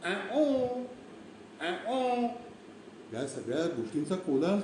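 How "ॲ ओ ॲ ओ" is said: high two-note peacock cry; mimicked peacock wail; peacock call. Shown as a demonstration. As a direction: imitate a peacock's alarm call, high and drawn out. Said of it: "तो मोर असा ओर-, ओरडतो".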